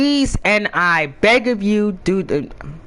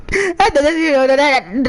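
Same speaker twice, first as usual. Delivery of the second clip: normal talking voice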